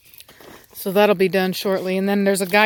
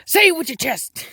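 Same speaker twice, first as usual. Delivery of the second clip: quoting a comedian; 6 semitones higher than usual. Retold or imitated. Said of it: "Who was that comedian?".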